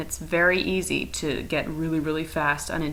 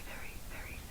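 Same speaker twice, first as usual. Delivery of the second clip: whisper; 20 dB quieter than usual. Shown as a demonstration. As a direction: whisper very, very almost silently. Whispered before loud contrast.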